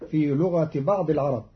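ordinary talk

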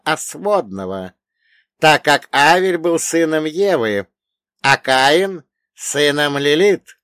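high, loud elder quizzing a pupil; old storyteller pitched high and forceful; wise old dead man. Acted. Old man continues the legend.

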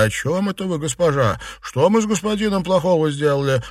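high-pitched frightened protest; trembling fear. A narrator's reading. Servant protesting innocence to his mistress, trembling fear. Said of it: "дрожа, поклонился".

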